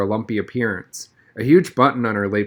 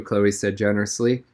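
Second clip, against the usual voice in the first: usual speaking voice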